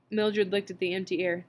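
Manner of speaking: usual speaking voice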